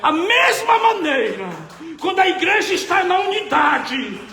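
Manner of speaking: loud, high, impassioned proclamation; preaching at full force. Real, not acted. Scene: testifying to the church that God saved him from death, preaching loudly. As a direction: shout the deliverance out to the congregation.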